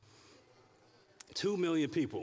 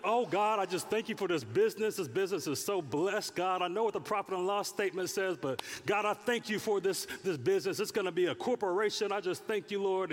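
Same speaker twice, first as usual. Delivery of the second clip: mock gushing prayer; 6 semitones higher than usual. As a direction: gush to God about your blessed business.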